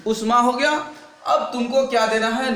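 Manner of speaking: ordinary talk, nothing marked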